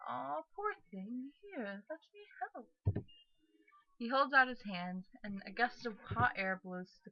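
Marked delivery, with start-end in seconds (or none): 0.1-3.0 high, slow, cooing sympathy; sympathetic brother. Acted. Brother offering help to wet sister, cooing sympathy. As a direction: coo it slowly in a high voice.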